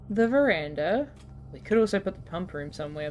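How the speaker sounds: normal talking voice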